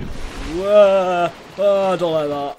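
real alarmed yelp, high and loud; startled yell. Real, not acted. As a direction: cry out as if caught off guard. Startled by the game.

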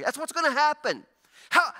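ordinary talk

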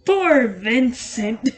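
slow, loud mock pity; pitying sigh. Streamer voicing game character, slow mock pity. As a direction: drag it out like a smug rival.

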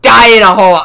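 high loud victory yell; triumphant shout. Real, not acted. Gamer landing the fortieth kill, triumphant shout. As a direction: scream the kill then cheer.

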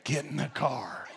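deflated mom sighing out the order; embarrassed mom quietly telling the kids; mom relenting. Retold or imitated. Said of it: "mom's like".

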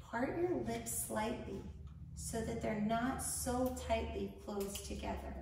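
unhurried instructions, leaving room to breathe; guided meditation. Acted. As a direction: guide a panicked listener calmly through each breath.

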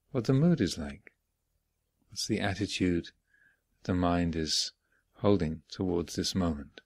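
unhurried phrases with long silences between; guided meditation. Real, not acted. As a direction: guide them gently inward, leaving space after each phrase. Leads guided morning meditation.

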